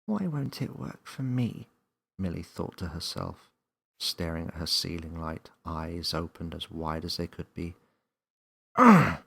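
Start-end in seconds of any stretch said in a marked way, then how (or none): 0.0-1.7 lightly voiced little girl, a bit higher; wondering aloud like a small girl; Millie's voice. A narrator's reading. 8.8-9.2 loud frustrated ugh, up high; frustrated growl. A narrator's reading. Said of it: "she frustratedly growled".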